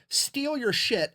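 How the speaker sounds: mock-indignant slow punch on steal; drawn-out emphasis. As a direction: stretch out steal your shit in mock outrage. German's outraged imagined rant.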